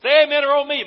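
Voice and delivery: loud rousing call for a response; preacher demanding an amen from the crowd; calling out to the congregation. Real, not acted. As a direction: shout it out to the pews.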